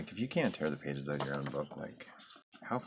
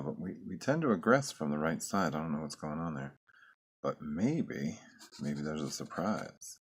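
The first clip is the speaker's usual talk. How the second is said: normal talking voice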